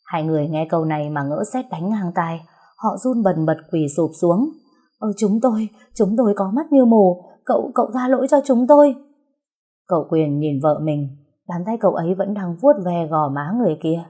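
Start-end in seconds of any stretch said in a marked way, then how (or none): 5.0-9.0 frightened, quickening plea; pleading. Acted. Tavern couple begging the young master, frightened pleading. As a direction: beg for forgiveness, stammering.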